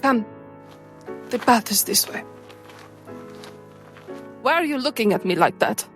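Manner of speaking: usual speaking voice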